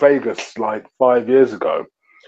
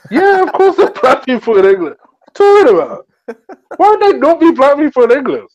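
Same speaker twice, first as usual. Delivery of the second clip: higher, exasperated "of course" reply; indignant retort; 13 semitones higher, 4 dB louder than usual. Retold or imitated. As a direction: push back like it's obvious. Retelling his own indignant reply.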